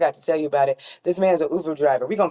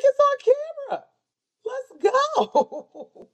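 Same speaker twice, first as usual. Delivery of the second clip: very high squealed mock taunt; hammed-up challenge; slower than usual. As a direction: imitate a fired-up driver in comic falsetto. Hammed-up retelling of driver's challenge.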